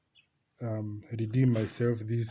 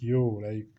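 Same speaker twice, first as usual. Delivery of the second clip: flustered bruh; 7 dB louder than usual. Retold or imitated. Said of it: "I'm like".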